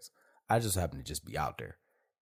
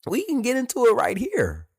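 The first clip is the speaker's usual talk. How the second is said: challenge; 10 dB louder than usual. Retold or imitated. Retold challenge.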